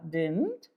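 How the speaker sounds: DIDN'T said as dint; contracted didn't. Shown as a demonstration. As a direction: say "didn't" in one syllable, no middle d. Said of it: "Pronunciation is didn't".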